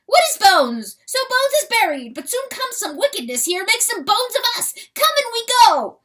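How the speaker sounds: very high, loud, wondering little weasel; excited weasel voice. Acted. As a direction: squeal it out in awe as the small weasel. Weasel pleads.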